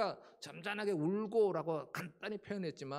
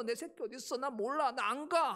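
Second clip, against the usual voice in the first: protesting mother cow; 7 semitones higher, 5 dB louder than usual. Retold or imitated. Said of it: "하고 막 이러는 것이 아니라".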